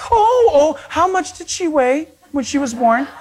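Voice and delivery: put-on high-pitched fawning over a baby; cooing women. Every woman walking by the baby, cooing high. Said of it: "Every woman that walked by".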